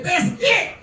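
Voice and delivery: high-pitched scolding wife, half-joking; wife hitting the ceiling. Retold or imitated. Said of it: "She hit the ceiling".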